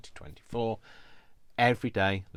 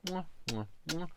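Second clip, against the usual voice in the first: air kisses; 9 semitones higher, 6 dB quieter than usual. Real, not acted. Blowing kisses at sign-off.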